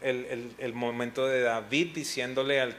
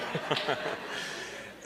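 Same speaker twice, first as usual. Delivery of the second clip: breathy laugh; 3 semitones higher, 3 dB quieter than usual. Real, not acted. Laughs after his joke.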